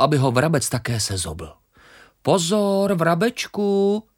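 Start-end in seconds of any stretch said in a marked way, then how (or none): 2.2-4.1 high little grain voice calling out a warning. Acted. Grain warns the sparrow, high urgent call. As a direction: cry out a warning as the tiny grain.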